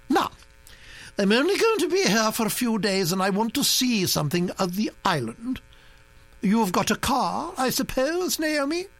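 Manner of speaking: high, commanding grand-lady voice; a bossy old aristocrat laying down plans; imperious old Duchess. A narrator's reading.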